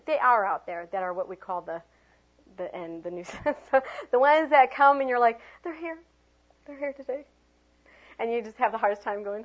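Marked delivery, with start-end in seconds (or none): 5.6-7.2 mock dread. Acting dread at nuisance child.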